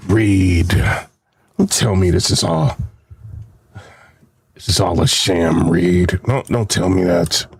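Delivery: low, dramatic mock pleading; mock plea. Begging Reed to say it's not all a sham, low mock plea. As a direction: beg Reed in a deep, hammed-up voice.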